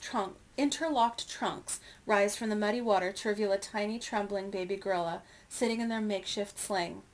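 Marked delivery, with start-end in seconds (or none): none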